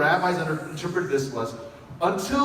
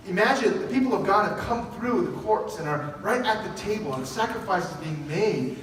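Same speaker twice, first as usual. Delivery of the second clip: ordinary talk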